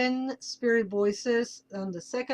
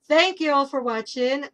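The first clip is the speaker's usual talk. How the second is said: normal talking voice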